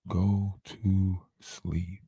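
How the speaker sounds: very slow, deep and soft; drawn out deep. Pretend. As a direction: stretch it out as she drifts off. Lulling to sleep, repeated.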